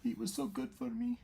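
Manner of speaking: high, soft grieving woman. Acted.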